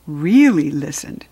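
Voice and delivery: ordinary delivery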